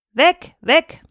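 high, sharp doggy yap; yapping old dog. A narrator's reading. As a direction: bark it out like an old chained dog. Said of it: "Er war etwas heiser".

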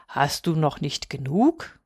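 low, slow question, softly voiced; admonishing old moon. A narrator's reading. Moon asks little Häwelmann to stop, slow admonishing. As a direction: ask slowly and low, like the patient old moon.

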